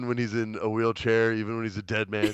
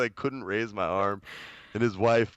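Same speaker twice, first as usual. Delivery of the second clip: ordinary talk, nothing marked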